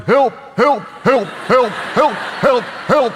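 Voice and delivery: high, loud yelping pleas; be a trapped dog yelping for help; dog crying for help. Retold or imitated. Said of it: "I'm trapped in the backyard".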